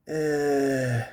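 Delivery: drawn-out comic groan; mock powerful grunt. Husband finishing in bed, hammed-up long grunt. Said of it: "I gave my powerful".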